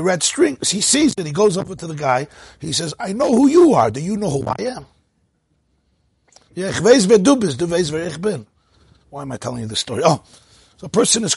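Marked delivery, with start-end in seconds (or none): 3.0-4.9 higher, louder opening, then easing down; excited joke character. Acted. Man in a joke accosting the stranger with his red string, mock excited. 6.5-8.5 higher, louder Yiddish punchline, easing at the end; excited punchline quote. Acted. Storyteller repeating the joke's punchline in Yiddish, mock excited.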